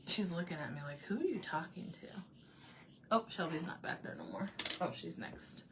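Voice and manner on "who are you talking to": imitated puzzled whisper. Voices the cat's puzzled look.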